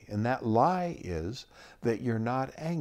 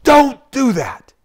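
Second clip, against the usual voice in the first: put-on sudden sharp high shout at a child; explosive scolding yell, much louder and higher; mother yelling; 12 semitones higher, 15 dB louder than usual. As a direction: yell it as a startling command. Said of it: "she yells at you".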